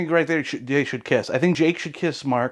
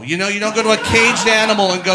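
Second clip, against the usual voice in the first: usual speaking voice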